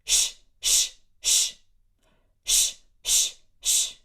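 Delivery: loud sharp shushing bursts; angry librarian shh. Shown as a demonstration. Teacher asks the class to shush like an angry librarian. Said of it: "very angry librarian".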